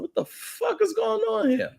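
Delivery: high, baffled outburst; stunned disbelief. Retold or imitated. Confronted by angry women, a high stunned disbelief. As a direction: pitch it way up in total confusion. Baffled reaction to being cursed out.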